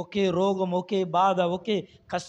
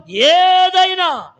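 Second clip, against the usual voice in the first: long, drawn-out shout, much higher; preacher's shout at the top of the build; climactic cry; 10 semitones higher, 11 dB louder, much slower than usual. Acted. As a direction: stretch the word into a shouted peak.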